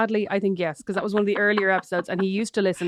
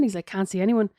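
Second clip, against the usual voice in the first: ordinary talk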